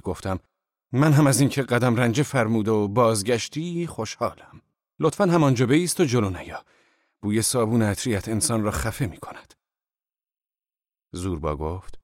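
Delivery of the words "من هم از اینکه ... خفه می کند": raised, louder voice of put-on anger; mocking anger. A narrator's reading. Boss greets returning Zorba, mockingly angry. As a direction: scold derisively, pretending to be furious.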